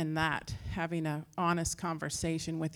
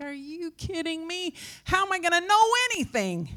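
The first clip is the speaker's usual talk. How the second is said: mock-outraged, voice shooting way up; acted incredulity; 10 semitones higher than usual. Woman answers being told not to question God, incredulous squeal.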